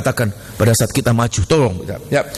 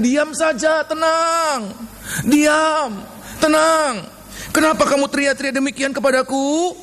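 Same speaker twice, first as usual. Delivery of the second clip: high, drawn-out command for calm; God's firm rebuke; 6 semitones higher than usual. Acted. God answering a people who keep shouting, firm rebuke. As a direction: order them to be still, pitched up and insistent. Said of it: "Tuhan katakan".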